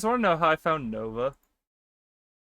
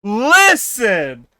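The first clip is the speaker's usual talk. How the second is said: high, loud, falling exclamation; loud protest; 6 semitones higher, 11 dB louder than usual. Real, not acted. Streamer defending themself to chat, loud protest. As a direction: cut in loudly to defend yourself.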